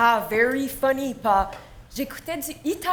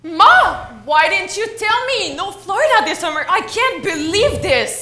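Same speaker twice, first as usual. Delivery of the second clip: loud, higher, indignant complaint; upset protest; 3 semitones higher, 7 dB louder than usual. Acted. Daughter confronting her mother over cancelled Florida trip, upset and loud.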